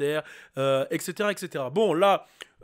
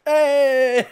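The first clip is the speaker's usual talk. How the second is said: loud hyped-up party shouts; mock West Coast shout; 6 semitones higher, 9 dB louder than usual. Imitating LA gangsters partying, shouting hey loudly. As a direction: yell it like a gangster at a backyard barbecue. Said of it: "et de crier juste".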